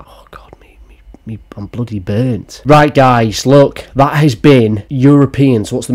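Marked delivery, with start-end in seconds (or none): 0.1-2.6 voiceless, worn-out whisper; spent aside. Real, not acted. Spent after laughing at the stories.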